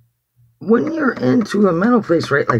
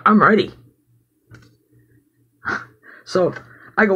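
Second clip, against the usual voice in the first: ordinary talk, nothing marked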